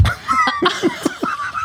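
squealing giggle; high laugh. Real, not acted. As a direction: burst out laughing. Wife laughing at the memory.

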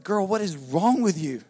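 a mock-baffled husband asking his wife; drawn-out baffled question; exasperated disbelief. Husband's exasperated retort retold.

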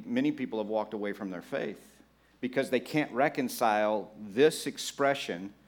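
normal talking voice

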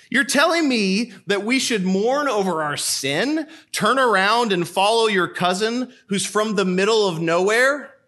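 a mocking critic scoffing at John the Baptist; scoffing objector. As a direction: play the sceptic who can't believe it.

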